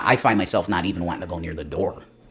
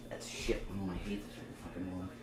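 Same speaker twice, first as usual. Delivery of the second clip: normal talking voice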